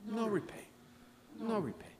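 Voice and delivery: usual speaking voice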